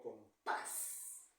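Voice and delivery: "compás" muy lento, con "pás" fuerte y alargado; acento en última sílaba. Shown as a demonstration. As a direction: alarga y golpea el final de "compás". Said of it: "la mayor fuerza de voz es al final". (sílaba final de "compás" bien marcada)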